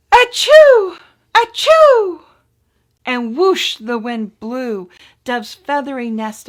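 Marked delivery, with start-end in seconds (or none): 0.1-2.2 two high, loud little sneezes; tiny sneeze. Acted. Cold baby mouse sneezing in the wind, high and loud. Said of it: "the mouseling sneezed".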